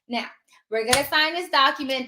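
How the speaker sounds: ordinary talk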